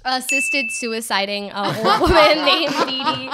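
loud, laughing interjection; laughing blurt. Real, not acted. Cohost cutting in on the recap, loud and laughing. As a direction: burst in while cracking up.

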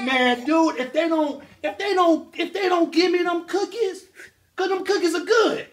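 put-on high, slow, pleading baby voice; voicing the baby. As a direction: beg for the cookies in a high voice. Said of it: "you was like".

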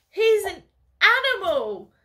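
loud high tearful cry; sobbing. Acted. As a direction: sob it out, outraged. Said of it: "Gret sobs".

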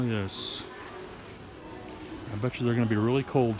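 ordinary talk

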